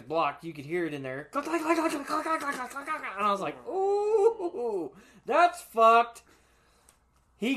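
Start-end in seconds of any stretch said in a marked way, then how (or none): 1.3-3.0 high, rapid engine knock clicks; high, fast click click click of a failing rod; imitated engine clicks. 3.7-6.2 high, pained ooh then a loud that's fucked; high wincing ooh, loud that's fucked; mock dismay. Reacting to engine noise.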